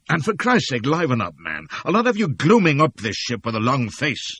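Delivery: loud, high gruff command; bully him into cheer; barked order. Acted.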